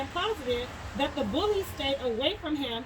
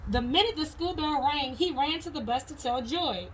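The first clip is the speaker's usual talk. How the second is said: ordinary delivery, nothing marked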